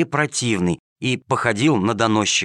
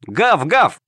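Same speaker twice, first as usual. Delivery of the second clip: mock barking chorus; 7 semitones higher, 4 dB louder than usual. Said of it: "ответили хором семь котят".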